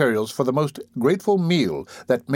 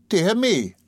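a high, surprised exclamation; lightly hammed-up surprise; exclaimed; 8 semitones higher than usual. A narrator's reading. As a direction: sound caught off guard. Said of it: "exclaimed the matron".

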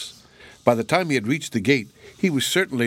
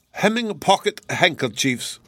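put-on slow, higher German-accented musing; 6 semitones higher than usual. A narrator's reading. German guest examining Lady Muriel's needlework, musing in his accent. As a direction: muse aloud slowly in a German accent. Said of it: "he said musingly".

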